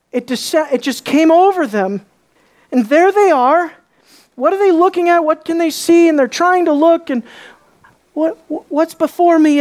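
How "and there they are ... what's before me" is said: high, wondering questions; acted bewilderment. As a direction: peer into the cloud, baffled, pitch held high. Acts out disciples peering into cloud.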